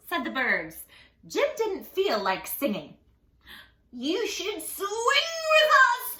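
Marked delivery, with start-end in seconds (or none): 3.9-6.2 much higher, slow, loud; playful monkeys. Acted. Monkeys inviting Jim to swing, high and playful. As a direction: call him to play.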